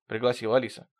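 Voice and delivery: ordinary delivery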